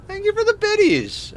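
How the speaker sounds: high squealed thank you; excited thanks. Real, not acted. Streamer thanking Admiral for bits, high squeal.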